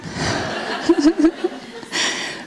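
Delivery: a high breathy laugh, almost silent. Real, not acted. Mother laughing while telling of her son.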